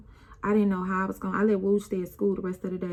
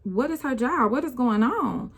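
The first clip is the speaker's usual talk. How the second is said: confused and alarmed; 5 semitones higher, 5 dB louder than usual. Retold or imitated. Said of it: "I'm just looking crazy".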